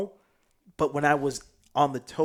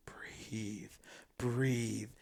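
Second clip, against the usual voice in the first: hushed, low retold coaching; calming whisper; 3 semitones lower, 10 dB quieter than usual. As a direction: whisper it slowly to settle someone. Helpers calming him during trip.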